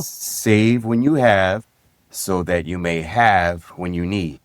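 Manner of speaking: slow and deliberate, split by a clear pause; slow repeat. Shown as a demonstration. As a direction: repeat the saying slowly, phrase by phrase. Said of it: "say that one more time, slow".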